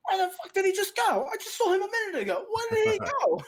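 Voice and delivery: very high, loud, baffled yelling; frantic bandit shouting. Acted. Bandits who lost sight of the hidden runner, frantic shouting. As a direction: shout it in rising confusion.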